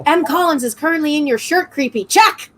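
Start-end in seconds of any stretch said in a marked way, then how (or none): 2.0-2.5 high, loud playful call; loud exclamation. Real, not acted. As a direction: shout it out gleefully.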